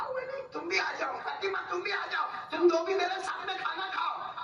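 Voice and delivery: high, gentle, loving call to sit and eat; the Prophet inviting Ali and Fatima to share the meal, high and tender; tender invitation. Acted. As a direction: invite them in with affection.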